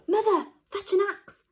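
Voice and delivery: high, loud and fast urgent call; shout. Acted. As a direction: yell for help while running. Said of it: "shouted Jack".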